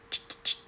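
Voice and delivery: knocking sound made with the mouth; quick ch-ch-ch door knock; imitated knocking. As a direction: mimic a rapid knock.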